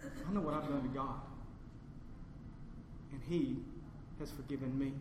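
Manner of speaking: hushed, low and halting; humble reply. Retold or imitated. Wronged person explaining forgiveness to the preacher, hushed and humble. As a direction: answer softly with long reverent pauses.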